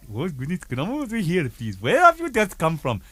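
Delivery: put-on Indian-accented customs officer. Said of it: "there he is, customs man".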